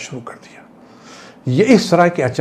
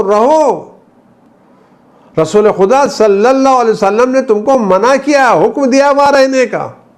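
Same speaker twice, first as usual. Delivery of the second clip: desperate pleading; 11 semitones higher, 4 dB louder than usual. Acted. Commander to his men deserting their post, desperate pleading. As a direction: beg them urgently, pitch soaring.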